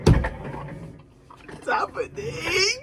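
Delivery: breathless, slow whisper. Real, not acted. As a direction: hush it out in awe. Whispered anticipation.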